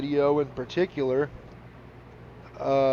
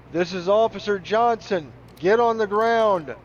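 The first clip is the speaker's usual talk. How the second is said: loud, high cop through a megaphone; megaphone police voice; 8 semitones higher, 7 dB louder than usual. Retold or imitated. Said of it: "a megaphone voice sound effect".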